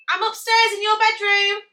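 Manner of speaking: loud call across the house. Real, not acted. Calling out to child upstairs.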